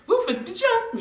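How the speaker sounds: mock them from above; taunting the friends, high and contemptuous; scornful jab.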